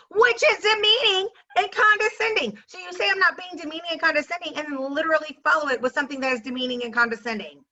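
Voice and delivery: high, indignant outburst, easing down; indignant rant. Real, not acted. Indignant rant dissecting the insult.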